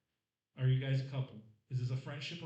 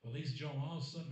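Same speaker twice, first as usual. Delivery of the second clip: usual speaking voice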